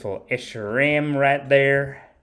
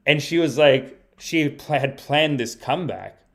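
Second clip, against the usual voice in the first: ordinary talk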